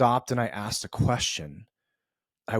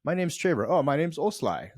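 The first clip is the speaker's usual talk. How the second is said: higher-pitched, lightly put-on Swedish accent; mock Swedish accent; 6 semitones higher than usual. Introducing himself with an odd name, mock Swedish accent. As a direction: playfully try on a Swedish accent. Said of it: "like, a Swedish accent".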